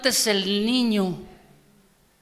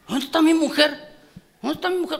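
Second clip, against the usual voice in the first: higher, impatient husband calling out for his wife; 7 semitones higher than usual. Retold or imitated. A husband looking for his wife, calling out impatiently. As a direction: call through the house like a puzzled husband.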